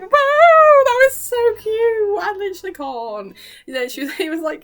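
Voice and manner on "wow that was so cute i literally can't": gushing squeal. Real, not acted. Fan gushing over cute scene.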